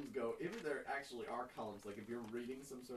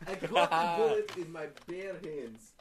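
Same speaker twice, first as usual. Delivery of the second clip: put-on loud, much higher, slow Russian accent; 5 semitones higher, 22 dB louder, slower than usual. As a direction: ham up a Russian soldier with the bullet. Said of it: "I like to do the Russian accent".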